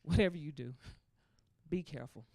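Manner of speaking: low, hushed caution; lightly voiced mother, grave and soft; mom's warning. Mother warning daughter on the phone, low and hushed. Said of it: "She's like".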